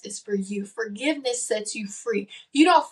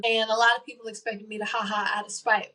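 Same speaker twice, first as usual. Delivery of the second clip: ordinary delivery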